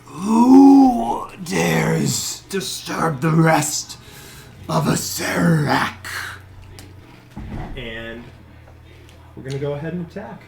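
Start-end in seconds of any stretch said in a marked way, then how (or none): 0.0-6.1 slow, loud snarling undead shout; ancient rasping undead king. Acted. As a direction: roar it out like a woken corpse king. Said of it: "contorts into a snarl as he says".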